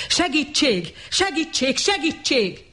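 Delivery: high, desperate calls for help; yelling for help. Acted. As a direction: cry out at the top of his lungs. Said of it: "torka szakatából ordította". (kiabálás segítségért, teli torokból)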